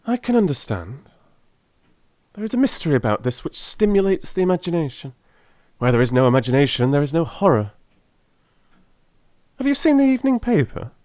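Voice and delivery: a keen detective carried away by his idea; Holmes voice. A narrator's reading. Holmes talks about imagination.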